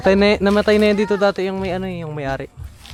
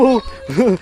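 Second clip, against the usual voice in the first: breathless laugh; 3 semitones higher than usual. Real, not acted. After running away. (tawang hingal)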